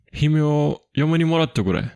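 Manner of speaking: eager minister. Retold or imitated. Minister telling Isumboshi to marry his daughter, eager and loud. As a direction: urge him to marry her, delighted. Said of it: "that's the daijin talking".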